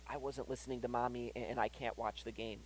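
very soft, low imitation of a sorry child; understated, close to tears but not crying; sorrowful confession. Son confessing he disobeyed Mommy, mildly sorrowful. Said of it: "And he starts bawling".

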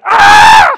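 high, loud wail of a hungry newborn; mock baby wail. Preacher imitating a hungry newborn, loud high wail. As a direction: wail like a baby screaming for milk.

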